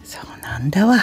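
breathy unvoiced aside, no voice at all; whisper. Real, not acted. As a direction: whisper it like a secret to the listener.

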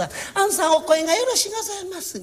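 high, coaxing flattery; butter up the student in a lilting high voice; お世辞の師匠. Acted. 師匠が弟子をおだてる. (高い声でおだてる師匠)

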